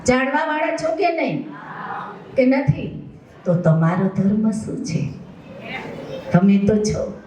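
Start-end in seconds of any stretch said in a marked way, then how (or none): 0.1-3.1 raised, high preaching call; fervent high-pitched call to the listeners; calling out to the crowd. Real, not acted. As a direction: demand an answer from the congregation. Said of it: "હજી એકવાર જોરથી બોલો".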